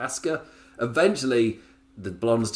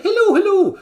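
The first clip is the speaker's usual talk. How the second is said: much higher, much louder hammed-up Irish leprechaun; a cartoon Irish greeting, full blast; over-the-top Irish; 12 dB louder than usual. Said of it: "full offensive Irish, leprechaun stuff".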